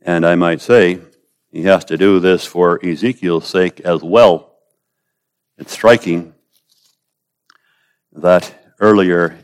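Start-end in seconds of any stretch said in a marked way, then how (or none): none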